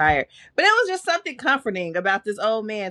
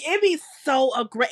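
ordinary delivery

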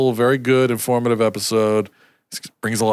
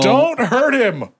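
high-pitched pleading; 12 semitones higher, slower than usual. Retold or imitated. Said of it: "Lewis was like".

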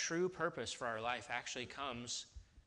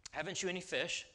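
put-on a light, cheeky English accent, pitched higher; mock English accent, playful and higher; 6 semitones higher than usual. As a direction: ask it the way an Englishman would, a little cheeky. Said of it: "we hear in an English accent".

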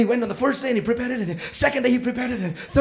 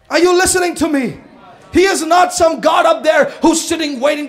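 loud, high, driving sermon delivery; preaching at full force; 4 semitones higher, 5 dB louder than usual. Acted. Pastor rousing the church, loud full-force preaching. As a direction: pound the message home to the congregation.

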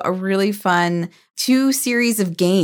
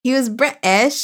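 mimicking a friend's bad British accent, higher; imitated British accent; 4 semitones higher than usual. Host echoing guest's fake British, mocking British accent. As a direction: echo it in mock British. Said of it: "likes to put on this horrible accent".

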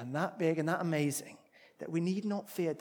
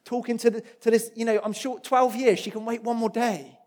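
raised, exasperated complaint, higher and louder; imagined fuming; 7 semitones higher, 14 dB louder than usual. Retold or imitated. Jairus, a desperate father, hurrying Jesus along, exasperated and raised. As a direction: protest at Jesus like a frantic father. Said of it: "could be legitimately fuming".

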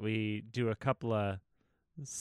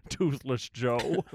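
ordinary talk, nothing marked

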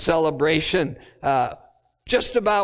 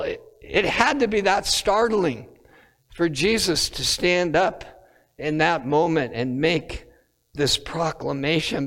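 normal talking voice

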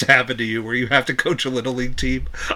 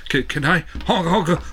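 silly clown; 3 semitones higher than usual. Retold or imitated. Mock line with laughing.

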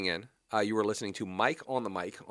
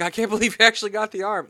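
a much higher, louder excited critter squeal; Rocket Raccoon voice; 10 semitones higher, 8 dB louder than usual. Retold or imitated. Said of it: "He's like".

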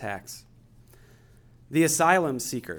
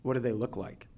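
guided meditation; 8 dB quieter than usual. Acted. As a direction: gently guide them into stillness. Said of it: "I'm gonna take us through a guided meditation".

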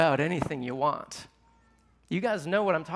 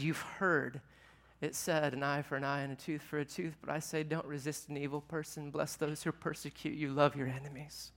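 soft Jesus quote; 3 semitones lower, 9 dB quieter than usual. Retold or imitated. Quoting Jesus, voice drops softly.

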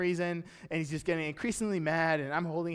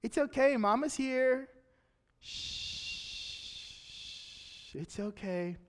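higher and softer, gentle shush; tender mother soothing; 5 semitones higher, 4 dB quieter, slower than usual. Retold or imitated. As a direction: soothe a crying baby as his mom. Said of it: "comes up to Zeke and says".

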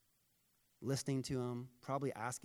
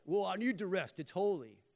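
whiny, louder put-on voice, higher; mock excuse; 6 semitones higher, 7 dB louder than usual. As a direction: sell the lazy excuse as if it were holy. Mocking a lazy excuse.

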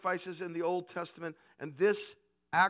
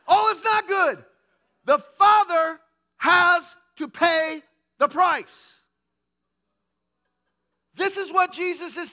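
much louder and higher, booming; full-force preaching; 10 semitones higher, 12 dB louder than usual. Acted. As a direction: thunder it out to the congregation.

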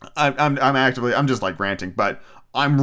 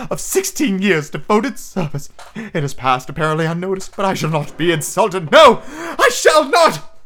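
choked, high theatrical indignation; Professor Trelawney; 7 semitones higher, 4 dB louder than usual. A narrator's reading. Teacher refusing to be insulted, hammed-up choked outrage. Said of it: "she choked".

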